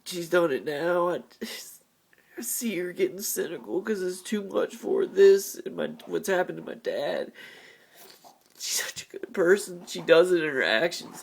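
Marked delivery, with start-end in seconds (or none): 2.4-7.3 tearful, pitched-up whisper; words breaking into a sob-whisper; crying. Real, not acted. Speaking about his mom's burden, crying. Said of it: "I'm sorry about the crying". 8.6-11.2 crying. Real, not acted. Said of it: "I'm sorry about the crying".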